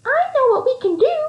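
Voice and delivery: high, bright little mouse. Acted. Mouse pitching an idea to friends, high and bright. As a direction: chirp it out like an eager mouse. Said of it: "said one of the mice brightly".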